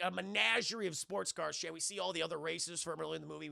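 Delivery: ordinary talk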